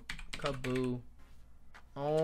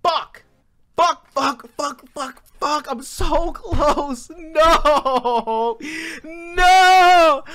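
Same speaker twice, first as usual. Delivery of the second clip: loud, high screams of frustration; real furious wail; anguished yelling; 12 semitones higher, 15 dB louder, much slower than usual. Real, not acted. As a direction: shout as the clock beats you. Time runs out, so close.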